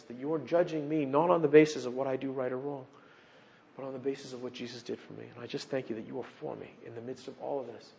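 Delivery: soft, intimate prayer voice; sample prayer. Pretend. Preacher modelling a private prayer for his congregation, softly. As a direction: pray quietly and sincerely. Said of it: "sample prayer".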